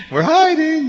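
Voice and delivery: put-on very high sing-song kid reply; squeaky child voice. A little kid calls out from the closet, very high. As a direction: squeak it out like a small child hiding. Kids answering from hiding.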